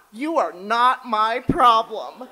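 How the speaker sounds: loud, slow, dismissive cat voice, laughing through it; snooty cat. Pretend. Other cats refusing her snuggles, haughty and loud. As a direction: play the aloof cat brushing her off.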